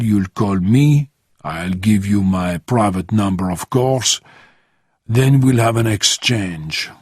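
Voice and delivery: put-on accented crime boss laying out terms; measured foreign-accented voice setting the deal; accented Santos, measured dealer. Santos dictates the exchange to Bourne, accented and deliberate.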